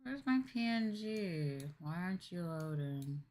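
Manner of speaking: ordinary talk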